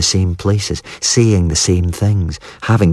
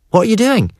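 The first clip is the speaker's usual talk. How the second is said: mock surprise. A narrator's reading. Sister bluffing her brothers.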